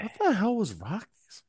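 baffled. Real, not acted. Baffled outburst about restaurant.

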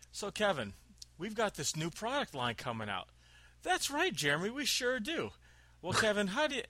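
mock corporate presenters. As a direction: ham up a scripted duo selling a product line. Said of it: "they're talking like".

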